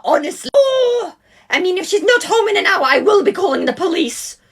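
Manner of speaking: a mum fretting about her missing daughter; worried mum. Acted. Mum calling the other mum about the missing girl, high and worried.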